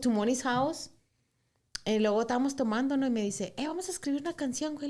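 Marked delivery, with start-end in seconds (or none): 3.6-4.9 quick, higher, tipsy excitement; sister's suggestion. Retold or imitated. Sister suggesting a song while drinking, fast and high. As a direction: toss out the idea fast and high.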